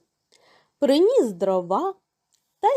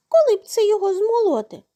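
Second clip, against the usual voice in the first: high, loud, quick mouse chatter; squeaky-high storybook mouse; little mouse voice; 10 semitones higher, 5 dB louder, faster than usual. A narrator's reading. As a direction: pipe up like an eager little mouse. Mice voiced in a folktale.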